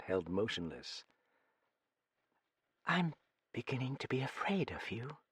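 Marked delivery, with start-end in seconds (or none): 2.9-5.2 hushed, slow, breathless with fear; slow near-whisper. A narrator's reading. Sally Wren, frightened, confides to Ben Calin in a slow whisper. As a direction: barely whisper it, afraid of him. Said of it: "in a slow voice, and almost in a whisper".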